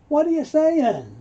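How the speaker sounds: high, loud indignant question; offended. Acted. Teacher acting the offended man's reply, indignant. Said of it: "he keeps getting offended".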